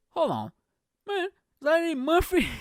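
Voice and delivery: high, loud burst of disbelief; surprised recognition. Retold or imitated. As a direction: act stunned at spotting a star. Acts officers recognizing a star.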